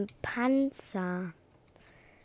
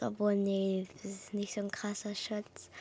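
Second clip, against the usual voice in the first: whisper; 2 semitones lower, 7 dB quieter than usual. Real, not acted. Whispering disappointed.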